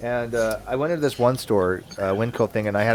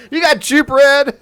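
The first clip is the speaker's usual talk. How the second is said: laughing; 10 dB louder, slower than usual. Real, not acted. Laughing at the joke.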